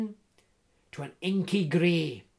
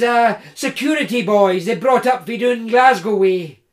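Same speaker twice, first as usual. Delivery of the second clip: big hearty Scots voice, louder and higher; jovial Scottish woodsman; 4 semitones higher, 10 dB louder than usual. Acted. Tree surgeon teasing Jim in the dark woods, hearty Scots. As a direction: tease him like a cheerful giant.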